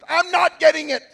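put-on whiny child protest. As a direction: whine it like a third grader. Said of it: "And I would".

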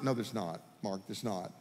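low, soft fatherly answer; acted dad voice, dropped low and hushed; reassuring dad. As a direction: settle a scared child quietly. Dad answers the frightened child.

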